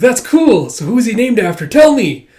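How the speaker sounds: put-on high, loud, insistent kid voice; eager child. Child asking about the dog's name, eager. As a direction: press for an answer like an excited child.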